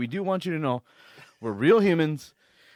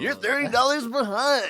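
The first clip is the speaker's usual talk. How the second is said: call center voice; 9 semitones higher than usual. Pretend. Mocking call center agent.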